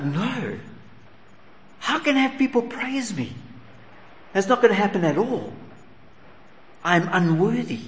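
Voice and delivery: a lightly voiced humble soldier refusing praise; voiced humble centurion. Preacher voicing the centurion, protesting his unworthiness.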